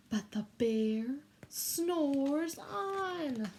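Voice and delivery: very slow, drawn-out chant; slow sing-song refrain for children to repeat. Shown as a demonstration. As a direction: stretch every word so the kids can join in. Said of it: "this is your turn".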